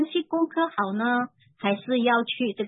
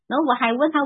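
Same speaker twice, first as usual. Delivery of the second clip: ordinary delivery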